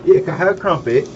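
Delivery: mock British accent begging for crumpet; fake British accent. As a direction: play up a silly British accent. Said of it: "'Cause you sound atrocious".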